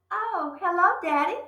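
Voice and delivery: put-on high, sweet girl's voice; pleasant little girl. Matilda greeting her angry father pleasantly. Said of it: "she said pleasantly".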